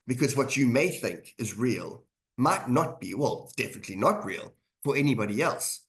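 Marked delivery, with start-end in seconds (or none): none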